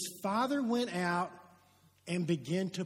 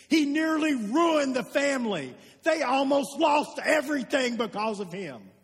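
louder, rising preacher's voice building in heat; forceful preaching; 6 semitones higher, 9 dB louder than usual. Acted. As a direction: preach it hard, pushing the outrage.

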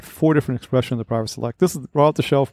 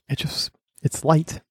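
whispering; 3 semitones higher, 3 dB quieter, slower than usual. Real, not acted. Savoring the bourbon's lightness.

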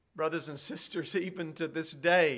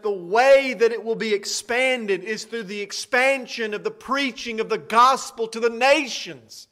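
climbing, louder preaching voice, pitch rising; louder and higher full-force pulpit delivery; 5 semitones higher, 8 dB louder than usual. Acted. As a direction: build the sermon to its peak. Closing push of the sermon.

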